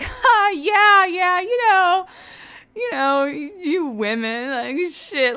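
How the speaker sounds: high, loud nervous laughing bro voice; awkward laugh. Retold or imitated. Host imitates Zach laughing off the comment, high and awkward. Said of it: "Zach starts laughing and he's like".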